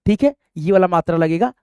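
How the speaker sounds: usual speaking voice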